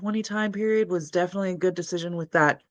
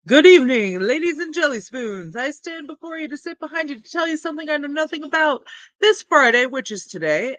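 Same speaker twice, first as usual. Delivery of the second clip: comic MC intro; 6 semitones higher, faster than usual. Acted. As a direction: ham it up like a master of ceremonies. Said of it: "I hope you guys liked my little intro".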